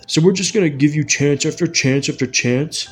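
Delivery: lightly put-on scornful boss voice; mock disbelief at a plea; mocking supervisors. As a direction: mimic an incredulous boss. Mimicking supervisors' scornful reply.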